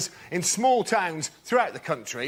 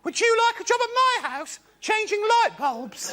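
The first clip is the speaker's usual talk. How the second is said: put-on old woman voice. Old woman asks tall comic to change bulbs, high old lady voice. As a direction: put on a little old lady asking sweetly. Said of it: "she asked me this question".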